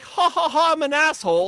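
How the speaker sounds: high, loud mocking laugh and brag; mock proud masshole. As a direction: ham up a smug laughing Bostonian. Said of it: "you're like".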